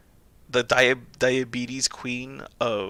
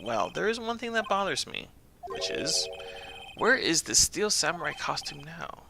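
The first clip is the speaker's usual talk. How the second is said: higher, lightly voiced trial characters; game courtroom voices; 7 semitones higher, 3 dB quieter than usual. Reading the trial game aloud, lightly voiced. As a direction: read each part with a touch of drama.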